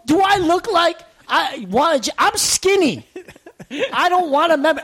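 high, exasperated protest; annoyed. Retold or imitated. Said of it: "I'm annoyed at this point".

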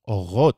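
"Orot" with a guttural R; Hebrew resh. Shown as a demonstration. Teacher showing different R's, "Orot" with throaty resh. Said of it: "Some of the R's are different".

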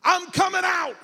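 announcing yourself from the kitchen; shouting. Retold or imitated. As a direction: shout it out as if behind a door. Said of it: "they started shouting".

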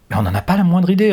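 cold, quick retort. A narrator's reading. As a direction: answer the captor coldly. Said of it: "répond Claude froidement".

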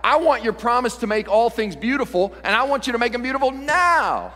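high, insistent plea to God; impatient, mock-pleading prayer; impatient demand. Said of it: "We're like".

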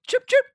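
high-pitched double chirp; imitated phone chirp. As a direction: mimic a walkie-talkie phone alert. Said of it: "the Chirp came out".

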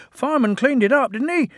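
high, fast little engine; defensive twin. Acted. Bill answering Ben, high and defensive. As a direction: answer back quickly and high. Twins quarrelling.

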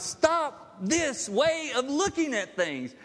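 high, loud and slow rebuke; Jesus urging Thomas, impassioned rebuke. Retold or imitated. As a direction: plead hard with him to stop. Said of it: "He's telling Thomas".

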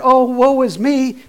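slow, loud, high mock wail of woe; mocking a whiner who thinks life is too hard; mock self-pity. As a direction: ham up the self-pity, stretched out. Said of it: "you think that".

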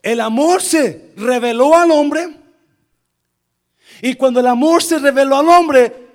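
loud, high, projected pulpit proclamation; full-force preaching. Real, not acted. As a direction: preach it out to the whole room.

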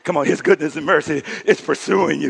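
ordinary talk

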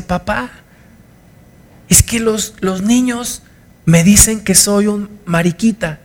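put-on a hurt little boy telling his dad; childlike plea. Niño se queja a su papá. (voz de niño dolido, aguda y suave)

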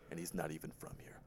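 fast whispered joke; whispered aside. Real, not acted. Co-host whispers a quick joke about the kicker. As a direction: drop to a quick conspiratorial whisper.

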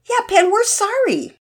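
put-on high, light girlish chorus; chiming girls. A narrator's reading. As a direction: chime in sweetly together. Two girls chime in.

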